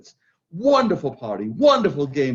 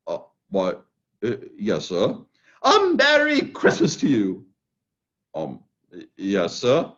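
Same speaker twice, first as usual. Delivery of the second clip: put-on much lower, hesitant old voice; flustered gentleman; 10 semitones lower than usual. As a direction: stammer in surprise.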